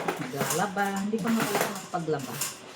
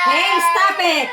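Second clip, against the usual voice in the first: loud, high, drawn-out command; scolding shout; 7 semitones higher, 11 dB louder, slower than usual. Real, not acted. Mother ordering wailing child to stop, shouting. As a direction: shout slowly over the wailing.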